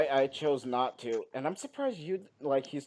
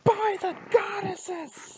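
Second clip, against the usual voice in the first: very high, drawn-out squeal; startled outcry; much slower than usual. Acted. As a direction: cry out in-character at a deafening blast. Character reacts to deafening horn blast.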